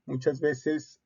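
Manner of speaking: ordinary talk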